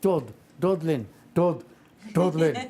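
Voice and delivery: slow "Dud", then "Dublin" said as "Dudlin"; syllable-by-syllable mispronunciation. Shown as a demonstration. Puppet teaching his partner, "Dublin" slowly said as "Dud-lin". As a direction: break "Dublin" down with a d for the b. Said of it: "say it like me".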